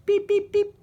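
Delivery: very high, clipped beep beep beep; squeaky machine beeps; high electronic beeps. A narrator's reading. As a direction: imitate a metal detector, tinny and high. Metal detector beeping.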